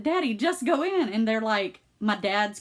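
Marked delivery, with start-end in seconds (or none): 0.0-1.1 high, impatient nudge; insistent urging. Retold or imitated. Daughter sending dad into his party, insistent. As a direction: push him along with raised pitch.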